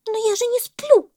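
very high, loud monkey protest; monkey's cry. A narrator's reading. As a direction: cry out as an indignant little monkey. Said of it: "закричала мартышка". (крик мартышки, громко и очень высоко)

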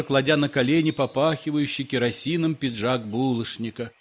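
ordinary delivery, nothing marked

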